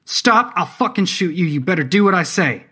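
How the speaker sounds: high, loud commanding shout; shouted threat. A narrator's reading. Artemis orders the broken-nosed man to stop, shouting. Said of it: "directing her shout toward the broken-nosed man".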